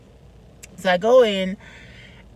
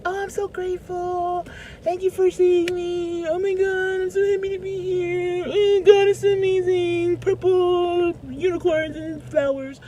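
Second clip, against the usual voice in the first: much higher, breathy, over-sweet, partly sung; gushing sugary voice; 10 semitones higher, 3 dB quieter than usual. Retold or imitated. Greeting the casting director, syrupy and much higher. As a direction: lay on the fake gratitude thick. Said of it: "And I'm like".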